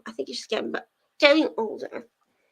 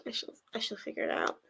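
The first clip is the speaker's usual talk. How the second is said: ordinary talk, nothing marked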